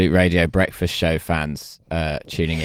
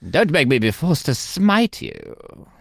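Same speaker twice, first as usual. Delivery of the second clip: higher, slow booming god voice; Zeus impression; 7 semitones higher, slower than usual. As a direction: smite them like a hammy Zeus. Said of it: "That is not Matt Berry".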